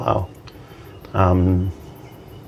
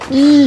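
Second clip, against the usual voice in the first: very high, louder "ee."; starstruck squeal; 6 dB louder than usual. Retold or imitated. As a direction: let out a thrilled little squeal. Recalled being starstruck.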